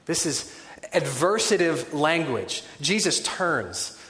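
normal talking voice

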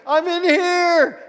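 loud, high, drawn-out holler; imitated mom calling out. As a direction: holler slowly through a closed door. Wife calls out from the bathroom.

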